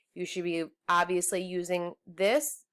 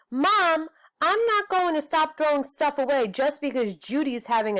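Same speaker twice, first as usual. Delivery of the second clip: whiny protest; 8 semitones higher than usual. Acted. Little brother protesting to Mom about his sister, high whine. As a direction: complain to Mom like a fed-up kid.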